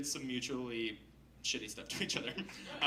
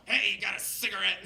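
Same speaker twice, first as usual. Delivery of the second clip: put-on loud, raised call from a kooky old guy; lightly voiced neighborhood oddball bumming a smoke; higher, louder, mock street holler; kooky street character; 7 semitones higher, 6 dB louder than usual. Bearded neighborhood character calls to him.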